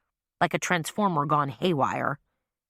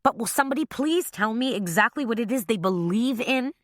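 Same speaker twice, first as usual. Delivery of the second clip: rising voice; 6 semitones higher than usual. A narrator's reading. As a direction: voice rising higher in exasperation. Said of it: "Now her voice rose".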